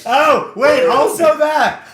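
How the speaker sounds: laughing. Real, not acted. Laughing at the joke.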